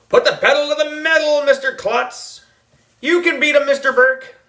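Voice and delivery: high, loud cheering from the crowd; root for the racers at the top of your voice; cheering yell. A narrator's reading. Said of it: "everybody was yelling and screaming".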